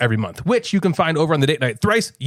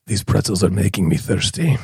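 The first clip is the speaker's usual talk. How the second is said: low, grovelly Eastern European accent; grovelly foreign accent, pitched well down; 11 semitones lower than usual. Shown as a demonstration. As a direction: put on a deep, grovelly accent. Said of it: "kind of like a grovelly accent".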